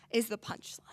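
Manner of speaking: whispered, dropping right away; ominous near-whisper; hushed punchline. Acted. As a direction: let it sink into a whisper. Dark poem lands its punchline.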